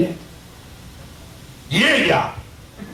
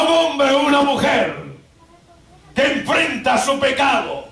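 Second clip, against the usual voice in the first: raised, measured pulpit proclamation; full-force preaching; 8 semitones higher, slower than usual. Real, not acted. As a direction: preach it phrase by phrase with long pauses.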